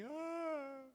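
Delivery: high mock whimper; fake crocodile sob. Child fakes a tearless cry, a high mock whimper. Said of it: "lágrimas de crocodilo".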